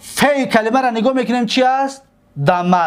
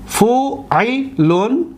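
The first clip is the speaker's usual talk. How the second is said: فوعلون very slow, with gaps between syllables; much slower than usual. Shown as a demonstration. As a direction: sound out فوعلون as fu, i, lun. Teacher sounds out impossible pattern.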